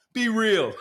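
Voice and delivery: high laugh breaking through. Real, not acted. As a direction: crack up mid-rant. Laughing at own joke.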